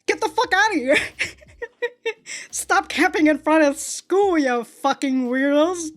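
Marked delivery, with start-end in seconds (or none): none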